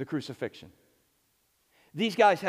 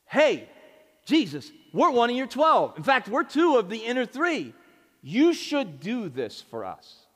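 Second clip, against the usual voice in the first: entitled ask; 6 semitones higher, 4 dB louder than usual. Retold or imitated. Entitled disciples voiced.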